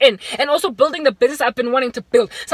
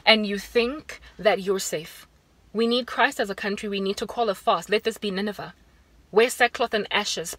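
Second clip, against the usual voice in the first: grave hush for humbling the nation; solemn hushed preaching; 4 dB quieter than usual. Acted. Solemn call to repentance.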